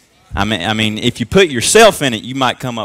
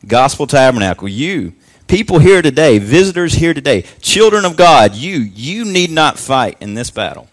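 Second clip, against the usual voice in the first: rising, louder preacher's call to the congregation; preaching at full force; 2 semitones higher, 6 dB louder than usual. Acted. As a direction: proclaim it over the church, building.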